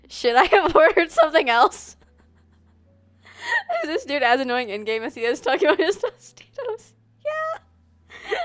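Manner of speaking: laughing hard, words breaking up. Real, not acted. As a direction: giggle through the question. Laughs at annoying character.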